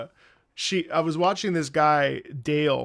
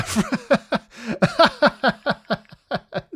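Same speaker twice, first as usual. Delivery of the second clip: long, high burst of real laughter; helpless laughing fit; 7 semitones higher, 4 dB louder than usual. Real, not acted. Streamer cracking up over accidental swearing, helpless laughter.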